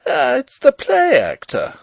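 very high, loud taunting shout; Rupert's cry. A narrator's reading. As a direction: cry out in mocking recognition. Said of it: "cried he".

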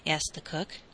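ordinary delivery

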